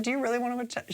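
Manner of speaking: soft, high mock challenge; imitating Abora. Host quoting Abora's comeback to an attack, soft high mock challenge. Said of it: "then Abora's like".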